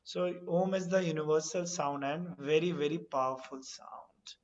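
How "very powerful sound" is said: whisper. Real, not acted. Reverent remark about Om.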